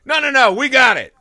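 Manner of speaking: high, loud brush-off; mock overconfidence. Waving away advice to ask someone else, mock overconfident.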